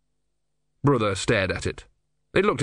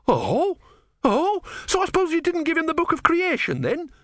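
very high, rapid indignation; outraged sarcasm. A narrator's reading. Disciple challenging his god, high-pitched outrage.

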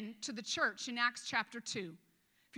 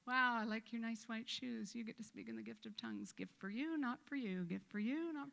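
mock God choosing favourites; 5 dB quieter than usual. Voices God picking favourites.

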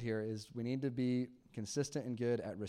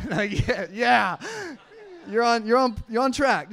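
laughing; 10 semitones higher, 12 dB louder than usual. Real, not acted. Laughing at daughter's mix-up.

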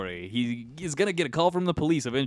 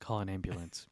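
mock last words; 4 semitones lower, 12 dB quieter than usual. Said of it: "his last words were".

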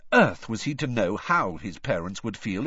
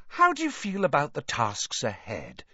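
Rita Skeeter; 6 semitones higher than usual. A narrator's reading. Rita interviews Harry.

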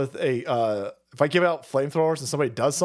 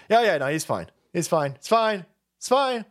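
ordinary talk, nothing marked

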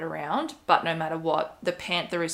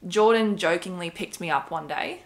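ordinary talk, nothing marked

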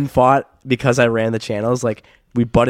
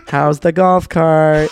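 slow, higher, louder teasing mom; mock sweet mom; 5 semitones higher, 6 dB louder, much slower than usual. Friend's mom teasing about the cart, slow mock sweetness. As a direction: stretch it out like a sly mom.

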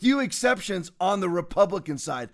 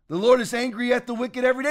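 normal talking voice

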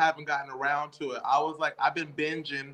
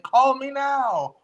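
put-on high, slow catchphrase in her accent; Miss Cleo; 9 semitones higher, 4 dB louder, much slower than usual. Showing how anybody can imitate her, drawn-out psychic accent. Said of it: "can definitely imitate".

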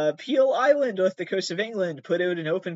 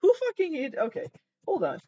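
surprised; 12 semitones higher than usual. Real, not acted. Shocked outburst at the news.

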